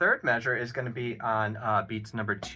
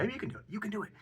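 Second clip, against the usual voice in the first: playful encouragement; 12 semitones higher, 8 dB quieter, much faster than usual. Real, not acted. Joking encouragement to student.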